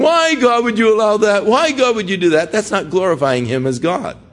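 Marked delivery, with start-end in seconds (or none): 0.0-2.5 mock challenging God.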